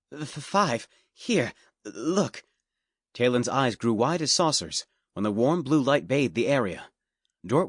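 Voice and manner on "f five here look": nervous stammer. A narrator's reading.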